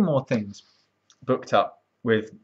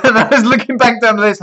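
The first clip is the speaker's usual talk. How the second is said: laughing; 9 semitones higher, 9 dB louder than usual. Real, not acted. Laughing while recalling the call.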